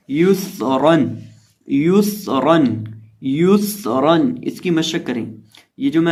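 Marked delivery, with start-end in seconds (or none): 0.1-4.4 युसरन slow, lips rounded on यु. Shown as a demonstration. Teacher drilling for practice, slow युसरन with rounded यु. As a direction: round the यु in युसरन and stretch the स before रन. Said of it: "होंठों को गोल करके पढ़ेंगे".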